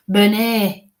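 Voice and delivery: Bernie said French-style as "buh-NAY"; French-sounding name. Retold or imitated. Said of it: "Sounds French to me".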